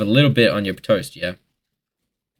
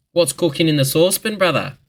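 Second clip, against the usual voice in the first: bright, much higher eager call; eager cook; 8 semitones higher than usual. Pretend. Vlogger acting an eager cook in the kitchen, bright and keen. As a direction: sound keen for the food.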